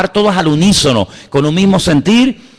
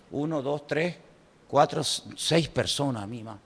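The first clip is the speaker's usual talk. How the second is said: muttered counting; 3 semitones lower, 15 dB quieter than usual. Retold or imitated. Counting the queue under breath.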